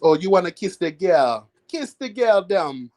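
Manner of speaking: a hint of a put-on Jamaican accent, sing-song; lightly voiced Jamaican crab, teasing; fake Jamaican accent. Vlogger doing Sebastian's song in fake Jamaican accent. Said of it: "Fake Jamaican accent".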